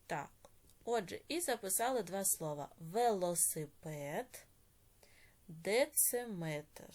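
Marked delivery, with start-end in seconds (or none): 2.8-6.9 drawn-out, carefully sounded syllables; slow dictation. Shown as a demonstration. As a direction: dictate slowly, word by word. Teacher dictates words for writing.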